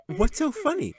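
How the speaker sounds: put-on girl's question. Katie asking the laughing fairy, high girlish voice. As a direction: ask it amused.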